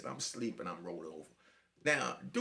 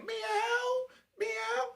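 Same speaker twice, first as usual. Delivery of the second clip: high, drawn-out cat wail; meowing cat. Retold or imitated. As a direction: meow long and high like a crying tomcat. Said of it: "meow and cry like a baby".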